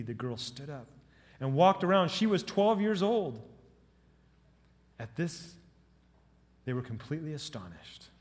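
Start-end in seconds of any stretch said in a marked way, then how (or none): none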